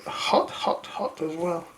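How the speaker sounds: whispered through hot food in mouth; breathy, quiet with scalding mouthful; mouth full of hot food. Real, not acted. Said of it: "Ooh, that's hot".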